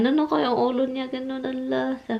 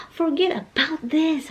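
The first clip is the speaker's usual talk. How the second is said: put-on higher, slow, coaxing voice as to a small child; like talking to a child; 7 semitones higher, slower than usual. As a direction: soothe her like a little kid, slowly. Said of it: "Sabi ko, parang bata ba?". (parang kinakausap ang bata, mabagal at mataas)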